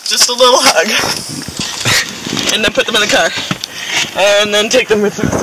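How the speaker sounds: shouting. Real, not acted. Shouting across the field.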